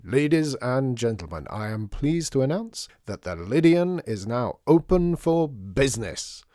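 slow, ringing showman's proclamation; grand announcement. A narrator's reading. Artie to the waiting customers at the opening, grand announcement. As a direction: announce it grandly, drawing out the last words.

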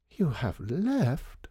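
high, drawn-out disbelieving question; slow, dramatic dismay, pitched high; heartbroken. A narrator's reading. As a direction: echo the news in heartbroken dismay. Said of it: "Missus Mountjoy sounded heartbroken".

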